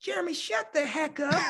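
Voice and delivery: imitating a frustrated, giggly telling-off; high-pitched coach voice, scolding while fighting a giggle. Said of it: "he has this frustrated giggle, but redirection voice in him".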